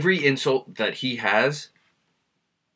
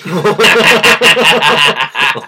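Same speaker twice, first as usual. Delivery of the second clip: high, loud burst of helpless laughter; real belly laugh; 6 semitones higher, 13 dB louder than usual. Real, not acted. Host cracking up at an outrageous clip, loud high laughing. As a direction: crack up loudly and uncontrollably.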